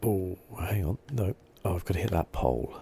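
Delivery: normal talking voice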